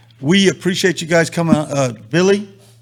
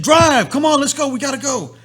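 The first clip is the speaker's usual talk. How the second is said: retold frantic order; 9 semitones higher, 6 dB louder than usual. Retold friend urging him on.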